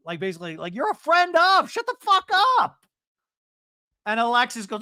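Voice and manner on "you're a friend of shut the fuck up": hammed-up screaming quarrel voice; imitated angry shouting. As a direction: shout them down in mock fury. Repeats Emily's shouted line.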